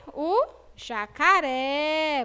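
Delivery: jacaré slow, open é held; drawn-out jacaré. Shown as a demonstration. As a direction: stretch out jacaré. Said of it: "o som dela é o quê? Aberto". (jacaré devagar, é aberto)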